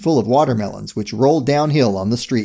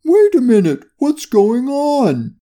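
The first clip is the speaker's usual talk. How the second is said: high, loud startled cry; startled question; 11 semitones higher, 3 dB louder, slower than usual. A narrator's reading. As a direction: draw out the bewildered question at a high pitch. Dog character's startled question.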